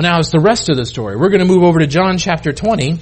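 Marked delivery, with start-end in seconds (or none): none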